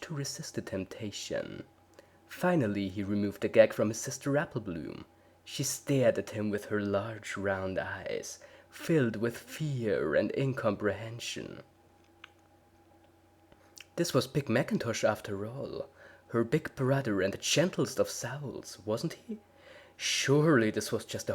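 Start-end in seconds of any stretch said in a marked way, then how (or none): none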